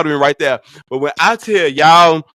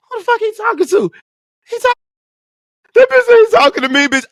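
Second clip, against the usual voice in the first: much higher, indignant retort; angry outburst; faster than usual. Retold or imitated. Friend turning round on the server, high indignant outburst. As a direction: snap back in offended disbelief. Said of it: "turned around and said".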